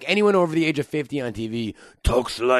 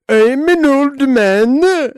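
much higher, louder, very slow Swedish lilt; Swedish old man; 13 semitones higher, 10 dB louder, much slower than usual. Shown as a demonstration. Friend asks for Swedish, high loud Swedish accent.

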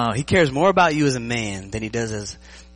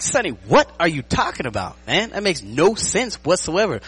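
higher-pitched, incredulous protest; mock exasperation; 7 semitones higher, 4 dB louder than usual. Player replying to his coach's pointless story, mock exasperation. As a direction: protest in hammed-up bafflement, stretching the last words.

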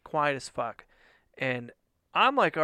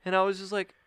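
ordinary talk

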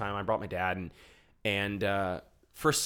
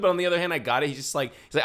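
ordinary talk

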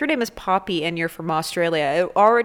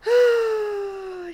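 high drawn-out theatrical sigh; exaggerated weary sigh; long sigh. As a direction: sigh like it's all too much. Dramatic sigh before segment.

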